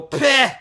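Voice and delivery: small high-pitched mock "peh"; little scoff noise. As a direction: imitate his short dismissive puff. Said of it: "he made his little".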